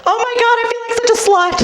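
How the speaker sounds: imitating the drunk girl at the bar; mock drunk party girl. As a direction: giggle like a tipsy girl. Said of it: "same girl, two hours later".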